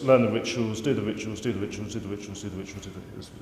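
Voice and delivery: tedious drone that dies off; droning rote repetition. Acted. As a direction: chant it by rote, sinking away to almost nothing. Mocking rote repetition of instructions.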